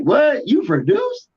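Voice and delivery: high, incredulous what, you produce; surprised question. Retold or imitated. Big Baby hearing his friend produces now, high surprise. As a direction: rise up high, caught off guard. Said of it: "And he's like".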